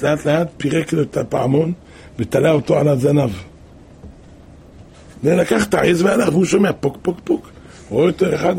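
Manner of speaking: ordinary delivery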